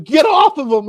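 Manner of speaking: filming guy yelling at the gator. Retold or imitated. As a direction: shout at the gator to let go. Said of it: "he's literally telling".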